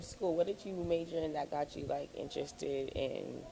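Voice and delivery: usual speaking voice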